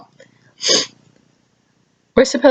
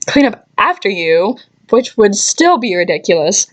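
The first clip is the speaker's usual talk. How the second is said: ordinary talk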